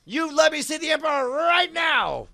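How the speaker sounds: high yelled ultimatum; desperate threat. Acted. Captor demanding to see the Emperor, yelled threat. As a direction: scream it like you mean it.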